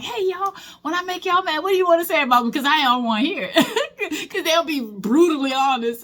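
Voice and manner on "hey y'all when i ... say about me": high, sing-song mock teacher's question; teacher asking class. Teacher pictures asking students what they'd call her, high and chirpy. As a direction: brightly pretend to invite the class to vent. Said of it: "and be like".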